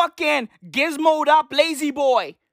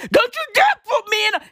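fast, high mock outburst; sudden indignant flare-up; heated warning; 11 semitones higher, 4 dB louder, much faster than usual. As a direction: fire it off high and rushed at the kids. Mock warning to future kids, flaring up.

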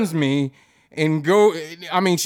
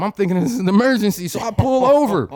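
ordinary delivery

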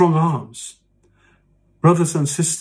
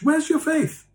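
much higher, strained holier-than-thou jab; shrill mock accuser; 12 semitones higher than usual. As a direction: squeak it out like a pious scold. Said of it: "we go up to one another and said".

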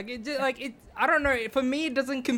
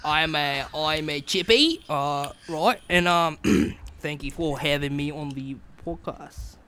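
slow, low Australian Bogan drawl; Bogan accent; 5 semitones lower, slower than usual. Shown as a demonstration. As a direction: introduce yourself as a laid-back Aussie Bogan. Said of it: "give us a good Bogan accent".

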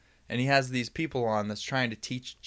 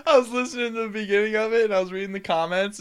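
laughing; 10 semitones higher, 4 dB louder than usual. Real, not acted. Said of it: "I'll call myself out on that one".

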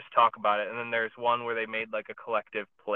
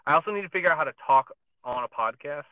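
ordinary talk, nothing marked

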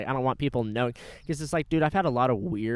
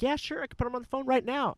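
much higher, breezy customer-service voice; cheery manager; 10 semitones higher than usual. Retold or imitated. Manager answering the caller, high and cheery. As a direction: sing it out like a helpful clerk.

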